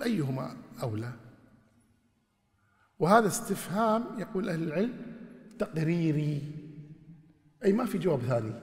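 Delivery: usual speaking voice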